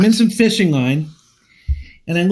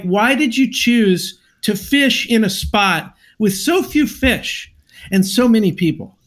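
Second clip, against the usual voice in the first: ordinary talk, nothing marked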